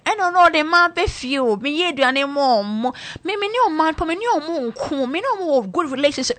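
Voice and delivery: high, whiny wife voice; mock devoted wife. Mocking wives who boast of suffering men, high whine. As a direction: mimic her, pitched well up.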